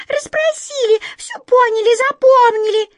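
chorus of tiny shrill mice; squeaking mice. A narrator's reading. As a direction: squeak it out excitedly. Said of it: "запищали мыши".

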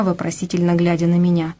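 ordinary delivery

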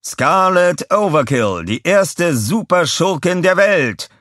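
slow, loud, drawn-out announcer call; booming prize announcer. A narrator's reading. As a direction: stretch the name like an arena announcer. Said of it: "rief der Ansager".

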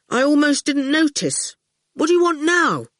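put-on cheeky boy brushing off a giant; young boy. A narrator's reading.

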